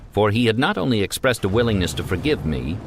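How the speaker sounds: plain reading voice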